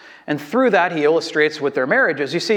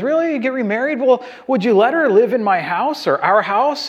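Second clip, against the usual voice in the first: high, needling questions as the wife; indignant wife; 6 semitones higher than usual. Retold or imitated. Wife grilling her husband about remarriage, higher and indignant. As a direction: push him, disbelieving and pitched up. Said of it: "She goes".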